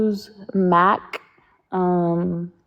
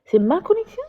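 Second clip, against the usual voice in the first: surprised question; 10 semitones higher than usual. Real, not acted. Checks whether her connection is bad.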